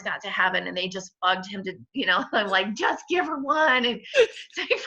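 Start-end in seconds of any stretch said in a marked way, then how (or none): 2.7-3.9 pleading. Retold or imitated. Begging God for a child. 3.9-4.8 high laughing burst on the words; half laugh, half cry. Real, not acted. Laughs at memory.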